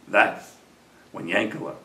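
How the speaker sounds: soft, low closing address to listeners; heartfelt moral. Real, not acted. Storyteller tells dear friends the lesson, soft heartfelt moral. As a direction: close the tale gently and earnestly, with long pauses.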